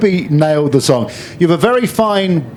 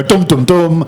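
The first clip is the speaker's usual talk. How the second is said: dramatic dum dum; 3 dB louder, slower than usual. Shown as a demonstration. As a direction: hum a dramatic music sting. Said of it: "We need sound effects".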